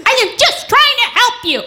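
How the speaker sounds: high-pitched indignant insistence; exasperated protest. Retold or imitated. Older sister insisting to younger one, exasperated high protest. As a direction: protest it high, as the wronged helper.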